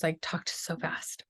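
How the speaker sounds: breathless whisper under the breath; sheepish whispered aside about talking too fast. Real, not acted. Self-conscious aside to audience.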